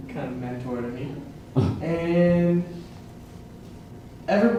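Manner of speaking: ordinary delivery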